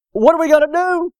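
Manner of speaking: high-pitched, acted cry for help; panicked servant. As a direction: plead in mock panic. Panicked servant asks Elisha.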